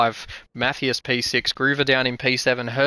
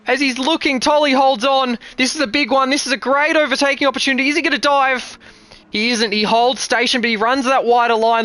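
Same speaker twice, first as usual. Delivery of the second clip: rising, louder play-by-play building to the finish; live race call; 12 semitones higher, 5 dB louder than usual. Real, not acted. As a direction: call the final lap faster and higher as it tightens.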